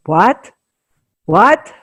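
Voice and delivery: put-on loud, rising Russian-accented what; a hint of a Russian accent, high and loud. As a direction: mimic a Russian accent, calling out. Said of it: "in the Russian accent".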